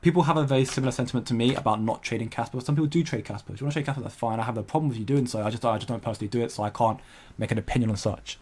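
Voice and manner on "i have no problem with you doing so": ordinary talk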